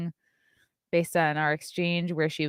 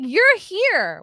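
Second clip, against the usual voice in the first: mock indignant; 13 semitones higher, 6 dB louder than usual. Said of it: "I was like".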